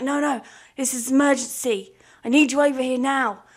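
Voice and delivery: loud, insistent demand; urgent boss. Retold or imitated. Boss insisting employee come over now, loud and urgent. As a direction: make it sound like an emergency.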